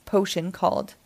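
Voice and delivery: usual speaking voice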